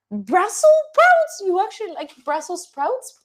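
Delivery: very high, slow, loud squawk; horrified high-pitched question; disbelief. Real, not acted. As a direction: recoil at the idea. Reacting to chat's suggestion.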